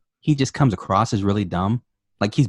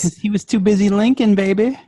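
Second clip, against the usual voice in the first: higher-pitched teasing pickup voice; lightly voiced smooth talker; 8 semitones higher, 3 dB louder than usual. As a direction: tease it like a hammy ladies' man.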